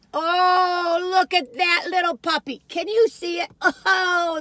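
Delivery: put-on high, loud, slow cooing baby talk; talking to food like a pet. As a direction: coo as if to a little puppy. Cooing at a cookie crumb.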